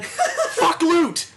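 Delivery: high, laughing outburst. Real, not acted. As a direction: blurt it out through laughter. Ranting about loot.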